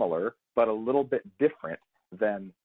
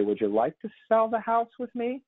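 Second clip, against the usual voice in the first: much higher, rising unsure question; uncertain upswing; 12 semitones higher than usual. Retold or imitated. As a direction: let the pitch climb up. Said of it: "listen to that, that tonality".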